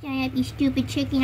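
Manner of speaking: ordinary talk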